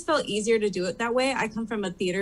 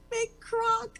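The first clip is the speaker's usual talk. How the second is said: put-on slow, much higher Australian accent on "big Croc"; Steve Irwin voice; 13 semitones higher than usual. Playing Steve Irwin on Snatch Game, slow high Australian accent. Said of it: "I went with Steve Irwin".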